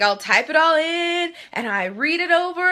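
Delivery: ordinary talk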